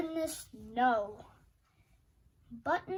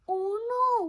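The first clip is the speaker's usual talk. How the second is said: mock dismay; 7 semitones higher, 7 dB louder than usual. Button lost.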